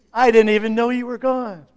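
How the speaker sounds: high, loud offhand shrug; mock indifference. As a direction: toss it off like nobody noticed. Imagined indifferent congregant.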